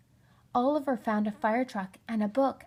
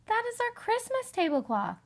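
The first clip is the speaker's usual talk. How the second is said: higher, lightly voiced older sister protesting; sister's objection; 13 semitones higher than usual. Amanda scolding Oliver over the tablecloth, high and shocked. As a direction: play the indignant big sister.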